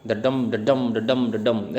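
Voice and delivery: "डडम" as da-DUM, evenly repeated; iambic rhythm. Shown as a demonstration. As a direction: chant "डडम, डडम" with a weak first beat and a strong second. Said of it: "इसको rhythm ही बोलते हैं".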